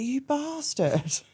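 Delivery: a drawn-out, high, slow curse at the lorry; exasperated curse. Retold or imitated. Cursing the lorry that pulled in behind her, slow and high. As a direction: stretch the insult out slowly in disbelief. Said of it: "and I was like".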